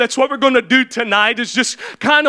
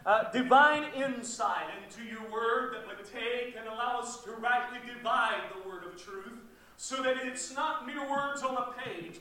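hushed, much softer praying voice; prayer; 2 semitones lower, 16 dB quieter than usual. Real, not acted. Preacher praying to the Lord before teaching, hushed prayer. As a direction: pray quietly and reverently to God.

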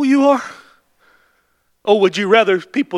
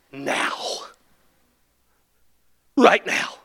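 awed whisper; 4 semitones lower, 6 dB quieter, slower than usual. Real, not acted. Soft awed close after laugh.

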